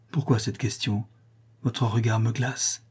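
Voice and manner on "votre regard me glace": ordinary delivery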